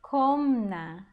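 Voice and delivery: Komna with a strong, drawn-out first syllable KO; stress on KO. Shown as a demonstration. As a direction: hit the KO in Komna, then let -na follow. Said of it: "a sílaba forte é o ko".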